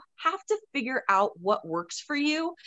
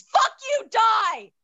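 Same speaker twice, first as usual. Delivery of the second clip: "Fuck you, die!" shrieked high and loud; a cartoon outburst of rage; high, loud mock fury; 11 semitones higher, 5 dB louder, much slower than usual. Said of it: "my instant response is".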